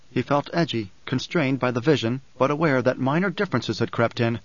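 ordinary delivery, nothing marked

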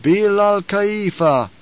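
high, slow ritual chant; intoned. A narrator's reading. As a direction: intone it like a congregation's response. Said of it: "intoned the troop".

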